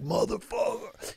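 high, slow, drawn-out mock curse from an angry driver; mimicked driver cursing. Stuck in traffic, the driver cursing at him in a high, slow mock voice. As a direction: play the guy in traffic swearing through the window, stretched out. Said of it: "he starts, like, flip, he's like".

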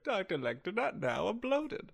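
high, hammed-up film heroine; mock Clarice refusal. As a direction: fend off Lecter in a silly high voice. Improvised Clarice refusing Lecter.

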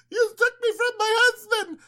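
high-pitched hammed-up lament; mock wailing wife. Abimelech imagines Sarah protesting, mock wail. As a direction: fake a tearful damsel's complaint. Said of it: "It's not like she's going".

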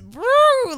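high-pitched swooping sound effect; swooping woo. Shown as a demonstration. Showing friend the tone flip, high woo. Illustrating the switch of tone.

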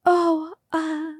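mock flustered. Mocking herself as flustered driver.

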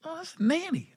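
soft, high-pitched mock innocence; offhand hushed reply. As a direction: imitate him brushing it off in a small high voice. Retold promoter's sheepish answer.

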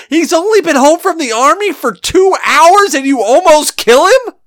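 high-pitched imitation of an outraged mom; furious mother. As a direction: imitate a mother scolding in disbelief, pitch soaring. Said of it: "all I can hear her say as she is beating me maliciously".

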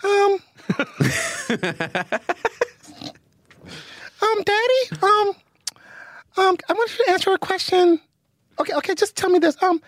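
put-on high little-kid voice, halting with ums; little Bry's voice. Dad on request doing his son's voice, high. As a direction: play a toddler asking Daddy a question. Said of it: "so little Bry's voice".

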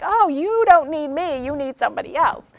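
high bright voice, then a quick whisper; lightly voiced helpline agent passing them on; cheery brush-off. Helpline agent redirecting a yelling caller, light and quick. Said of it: "I'd be like".

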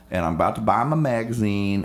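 normal talking voice

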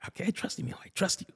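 a hint of a tearful mother; soft mom. Mom letting son go, soft and half whispered.

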